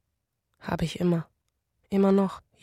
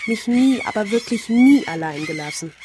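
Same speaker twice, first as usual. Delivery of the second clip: lauter werdend, vorwurfsvoll; bitterer Vorwurf; 2 semitones higher, 5 dB louder than usual. Acted. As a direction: jedes nie anklagend betonen. Vorwurf im Streit mit Mutter. (lauter, bitterer Vorwurf)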